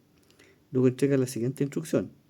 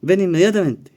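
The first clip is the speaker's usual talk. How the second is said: loud, higher, furious command; angry father shout; 6 semitones higher, 12 dB louder than usual. Acted. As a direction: bark it at the son who won't come. Angry father orders son. (padre furioso gritando a su hijo)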